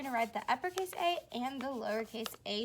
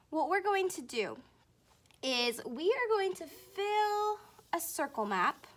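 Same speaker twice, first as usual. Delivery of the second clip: ordinary talk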